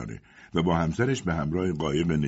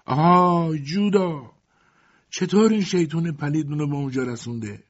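mournful call; 9 semitones higher, 4 dB louder than usual. A narrator's reading. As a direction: call out plaintively across a distance.

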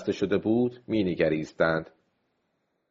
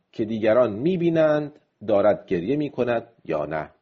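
usual speaking voice

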